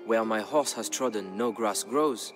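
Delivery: plain reading voice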